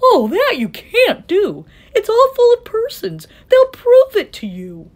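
high, loud, urgent elephant; alarmed protest. A narrator's reading. Horton protests that the speck holds persons, alarmed. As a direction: plead against it in alarm.

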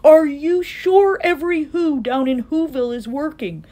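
high, urgent elephant call; calling back. A narrator's reading. Horton urges the mayor to find shirkers, calling back. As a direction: press them with anxious questions. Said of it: "And Horton called back".

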